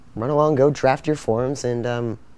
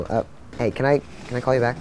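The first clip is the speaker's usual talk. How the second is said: ordinary talk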